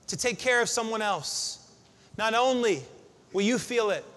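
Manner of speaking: ordinary talk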